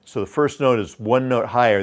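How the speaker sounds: normal talking voice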